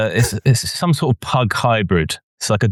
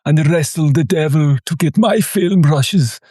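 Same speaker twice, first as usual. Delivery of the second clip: put-on slow, higher German-accented Herzog drawl; slow German accent, grave and intense; Werner Herzog impression; 5 semitones higher, slower than usual. Podcaster imitating a film director, slow German accent. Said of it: "I hope I haven't brought this impression out".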